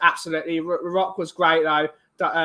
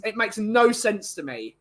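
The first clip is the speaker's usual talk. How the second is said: ordinary talk, nothing marked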